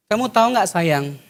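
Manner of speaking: soft, low lover's murmur; sweet talk. Acted. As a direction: coo it softly to a girlfriend. Sweet-talking boyfriend voiced. (pacar merayu dengan suara lembut dan rendah)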